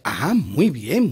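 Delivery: slow, rising "muy bien" drawn out long; slow drawn-out praise. Acted. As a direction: stretch it out, slow and sing-song. Teacher drawls praise slowly.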